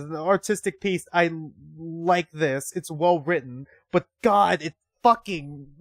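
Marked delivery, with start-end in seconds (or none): none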